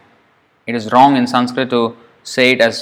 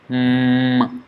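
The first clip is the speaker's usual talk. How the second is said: long nasal hum through the nose; humming mm; 5 dB quieter than usual. Shown as a demonstration. Teacher demonstrating nasal sound with a long hum. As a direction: hum with lips closed. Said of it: "That's why it's called nasal".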